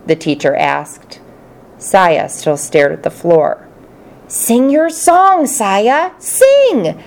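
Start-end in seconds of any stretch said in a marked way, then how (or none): 4.3-7.0 high squeaky hamster cry, loud as it can go; squeaky hamster shout. Acted. Hamster cheering on the shy girl, loud and squeaky. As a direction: squeak it out with all your might. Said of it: "I squeaked out as loudly as I could".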